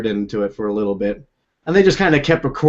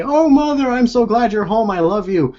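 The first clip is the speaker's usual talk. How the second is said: high, overdone, sugary welcome, easing down toward the end; mock sweet greeting; 10 semitones higher than usual. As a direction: pour on fake affection to spook her. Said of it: "Or I'm just gonna turn around and say".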